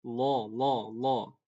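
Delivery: "Lo" with a thick dark l and o; heavy lam of Allah. Shown as a demonstration. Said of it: "Itu dibaca tebal".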